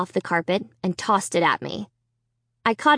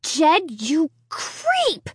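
angry cry; 8 semitones higher, much slower than usual. Acted. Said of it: "I cried".

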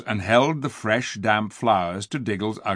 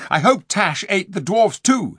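spiteful; 8 semitones higher, 5 dB louder than usual. Acted. Eustace's spiteful wish.

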